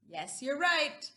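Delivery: ordinary delivery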